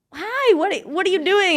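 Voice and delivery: high, startled question at the door; surprised. Retold or imitated. Retelling opening the door to the neighbor, startled and high.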